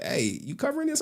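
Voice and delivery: high, chirpy retold question; a high friend's voice asking about the story. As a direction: ask it high and eager. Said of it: "I was like".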